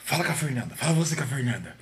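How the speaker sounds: low, very soft reluctant instruction; imitating a friend's quiet unease; hushed deflection. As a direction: pass it on under the breath. [name]'s acted reluctant passing of blame.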